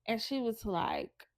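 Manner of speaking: usual speaking voice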